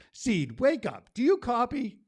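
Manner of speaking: high, loud call like a radio check. Acted. Poet hailing the seed like a radio operator, loud and high. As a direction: hail the seed over the radio.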